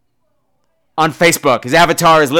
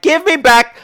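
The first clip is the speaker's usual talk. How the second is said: mock whiny voice; 10 semitones higher, 4 dB louder than usual. Mocking a rival's whiny demand.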